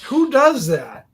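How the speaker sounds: put-on indignant drunk. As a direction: drag it out high like a baffled old drinker. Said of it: "He goes".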